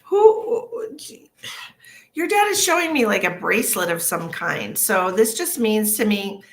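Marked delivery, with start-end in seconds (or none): 0.0-3.0 startled. Real, not acted. Startled mid-sentence.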